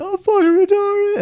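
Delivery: shrieking very high, slow and loud; screaming at yourself in the street; frantic yell. Retold or imitated. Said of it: "standing in the middle of the street".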